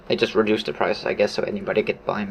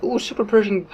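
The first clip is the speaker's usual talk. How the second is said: mock excitement; 8 semitones higher than usual. Fake excitement over small change.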